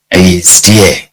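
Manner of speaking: ordinary talk, nothing marked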